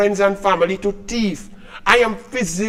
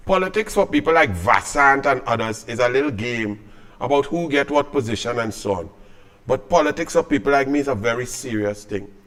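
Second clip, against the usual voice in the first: grave and serious; 8 semitones lower, 7 dB quieter than usual. Real, not acted. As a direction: lower and softer, solemn conviction. Solemn statement of principle.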